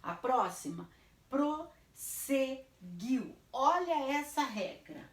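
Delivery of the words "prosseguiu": "prosseguiu" very slow, in separate syllables. Shown as a demonstration. Teacher gives the next word, "prosseguiu" syllable by syllable. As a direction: break "prosseguiu" at each syllable. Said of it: "eu vou separar".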